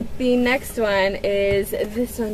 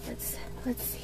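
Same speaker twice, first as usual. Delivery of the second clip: whisper; 15 dB quieter than usual. Real, not acted. About to open package.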